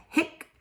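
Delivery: "hic" said carefully as a single word; pronunciation demo. Shown as a demonstration. Teacher pronouncing Latin for parents, "hic" said clearly. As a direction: say "hic" clearly for learners to copy. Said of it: "I will pronounce them for you".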